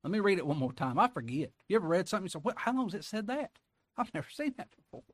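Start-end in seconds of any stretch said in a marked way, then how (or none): 2.4-5.0 much higher, astonished discovery; mock amazement. Preacher imitating a reader stunned by a verse, high and amazed. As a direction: gasp at something new in the text.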